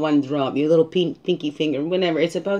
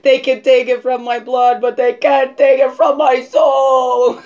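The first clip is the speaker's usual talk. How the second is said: defiant declaration; 8 semitones higher, 8 dB louder than usual. Retold or imitated. To herself, rejecting ancestry being bred out, a loud defiant cry. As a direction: proclaim it like a battle cry against being bred out. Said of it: "I was like".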